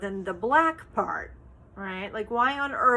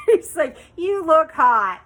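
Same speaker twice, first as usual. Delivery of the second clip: laughing; 7 semitones higher, 10 dB louder than usual. Real, not acted. Quoting husband, still laughing.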